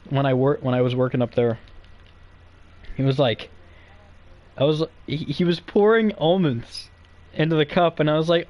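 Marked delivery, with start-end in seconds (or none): none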